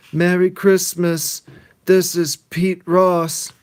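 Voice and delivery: high, loud, slow mock phone greeting; hammed-up stiff phone voice; Pete answering phone. Pete answering the ringing phone, hammed-up. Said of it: "After he says".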